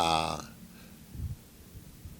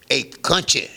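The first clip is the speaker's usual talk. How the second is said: loud, higher, commanding bark; gruff boss call; 7 semitones higher, 6 dB louder, slower than usual. Retold or imitated. Mob boss to young musician, loud gruff command. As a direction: summon the kid like you own the room. Boss calls his man.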